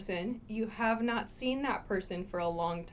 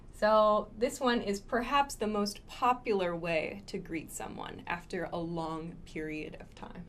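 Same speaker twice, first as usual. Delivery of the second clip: normal talking voice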